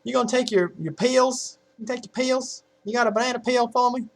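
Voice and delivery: high-pitched mock Southern parent; Southern accent. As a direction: imitate a Southern accent, pills stretched toward "peels". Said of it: "listen to people say".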